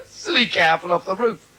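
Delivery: usual speaking voice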